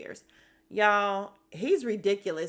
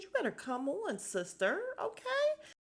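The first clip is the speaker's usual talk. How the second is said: sassy hype, the okay shooting way up; sassy cheer; 12 semitones higher, 3 dB quieter than usual. Retold or imitated. Scene: vlogger cheering on the sister, sassy high okay. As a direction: egg her on, big rising okay. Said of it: "And I said".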